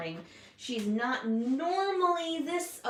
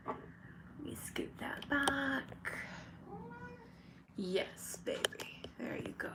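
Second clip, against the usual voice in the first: whispering; 4 semitones higher, 11 dB quieter than usual. Real, not acted. Cooing to her bird.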